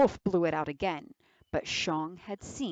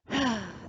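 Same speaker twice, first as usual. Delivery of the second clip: put-on long, weary old sigh; wolf pretending to be old and frail, a heavy sigh; theatrical sigh; 3 semitones higher than usual. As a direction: sigh as if your bones ache. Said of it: "The wolf gave a sigh".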